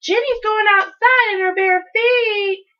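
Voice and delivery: high, loud, drawn-out holler; singled-out sibling yell; bawling tattletale. A narrator's reading. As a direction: bawl it out slowly to tattle. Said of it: "he bawled".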